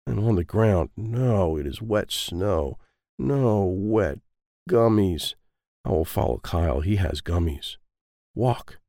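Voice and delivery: plain reading voice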